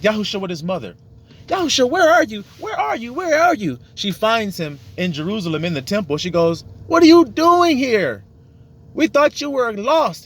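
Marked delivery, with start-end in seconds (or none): 1.5-3.8 much higher voice, calling out again and again; mother searching for her son, calling his name; anxious mother calling. Retold or imitated. 6.9-10.2 much higher, louder, slow reproach; mother finding her son in the temple, high and reproachful; relieved scolding mother. Retold or imitated.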